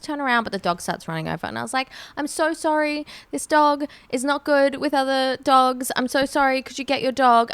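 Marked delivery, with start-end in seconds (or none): 2.2-7.5 higher-pitched, pleading apology; anxious apology. Retold or imitated. Apologising to a stranger over dogs.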